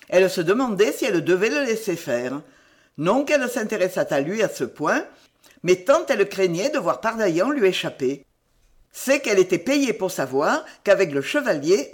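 plain reading voice

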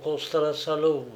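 ordinary delivery, nothing marked

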